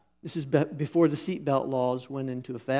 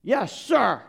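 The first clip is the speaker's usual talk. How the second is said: higher, louder crisp military reply; mock obedient yes sir; 8 semitones higher, 4 dB louder than usual. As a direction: snap it out like a dutiful soldier. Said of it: "you just look him in the eye and you say".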